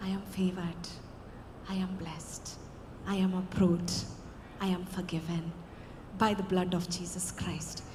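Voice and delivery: soft, low confession of faith; softly saying blessings over yourself; quiet affirmation. Shown as a demonstration. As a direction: speak it gently into God's ear. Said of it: "దేవుని చెవిలో ఏం చెప్పాలి".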